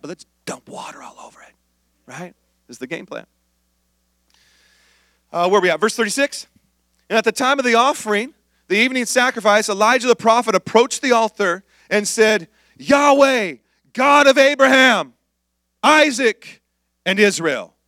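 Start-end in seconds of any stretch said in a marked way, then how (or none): 0.0-3.3 whispered, letting them in on a secret; scheming whisper. Pretend. Preacher as cocky Elijah sharing his plan, in a whisper. 12.8-17.7 raised, ringing call to God; solemn invocation. Acted. Elijah calling on Yahweh at the altar, raised and solemn.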